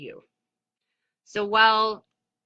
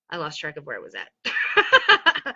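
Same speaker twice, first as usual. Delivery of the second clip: laughing; 6 semitones lower than usual. Real, not acted. Laughs at losing her place.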